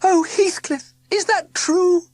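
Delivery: very high, loud, yearning cry; Belladonna crying out. A narrator's reading. Belladonna emerging to ask Heathcliff, high and loud. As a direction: call out to him full of hope. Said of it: "cried a voice".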